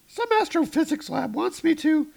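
high falsetto Jane on the phone; hammed-up high Jane voice; Jane's voice.

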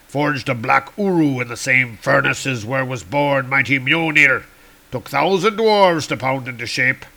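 broken-English dwarf, loud start. Acted. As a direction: speak like a blunt, proud dwarf. Dwarf Screwbeard speaks to Thor.

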